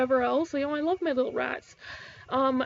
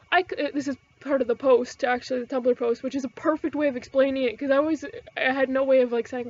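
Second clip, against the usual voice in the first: normal talking voice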